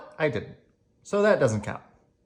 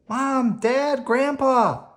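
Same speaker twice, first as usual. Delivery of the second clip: put-on high, loud child's cry for parents; child calling for mom and dad from pain; 6 dB louder, much slower than usual. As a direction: call out for your parents in pain.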